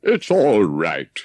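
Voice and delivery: lightly voiced Rat, slow and easy; a hint of a breezy water rat putting him off; the rat's voice. A narrator's reading. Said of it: "the rat would say".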